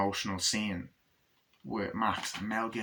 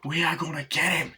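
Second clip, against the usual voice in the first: intense; 6 semitones higher than usual. Acted. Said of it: "it's, like, this intense scene".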